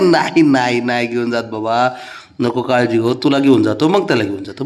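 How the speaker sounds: low, soothing promise; tender reassurance. Real, not acted. Telling the jealous one not to worry, reassuring.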